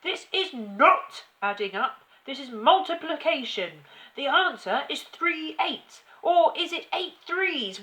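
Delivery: loud, high hectoring tirade; yelling tyrant. A narrator's reading. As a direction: browbeat the boy like a fierce headmistress. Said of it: "yelled the Trunchbull".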